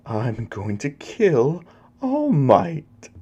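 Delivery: slow, high-pitched villain voice; villainous threat. Acted. Evil newcomer declares murderous intent.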